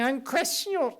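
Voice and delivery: put-on a brief, high-pitched stab at an Australian accent; Australian accent attempt. Lecturer quoting a smarty-pants juror, trying an Australian accent. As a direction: start the quote in a shaky Australian accent, then give up. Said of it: "I can't do an Australian accent".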